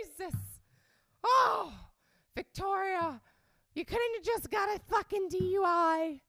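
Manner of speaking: high, very loud nasal wail; exasperated nasal mom. Retold or imitated. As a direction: wail in motherly despair, through the nose. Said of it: "I always make her voice like that".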